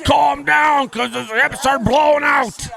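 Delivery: high, loud, slow mock shout; mock shouting Joe. Vlogger mocking Joe's shouting about hips, high loud mock shout. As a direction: ham up a shouting drunk complaining about hips.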